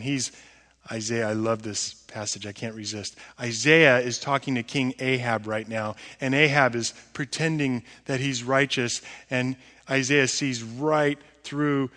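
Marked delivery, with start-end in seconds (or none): none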